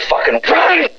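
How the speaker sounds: high-pitched cursing; angry insult. Pretend. Caller insulting the other end, angry high outburst. Insult during prank call.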